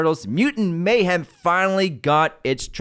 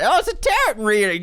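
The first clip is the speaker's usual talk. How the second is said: high, mimicked southern twang; mock southern accent; 7 semitones higher than usual. Co-host mimicking his friend's line, mock southern accent. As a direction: imitate your co-host's southern accent, pitched up. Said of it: "What's with the southern accent?".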